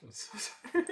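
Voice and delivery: laughing through it. Real, not acted. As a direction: giggle while saying it.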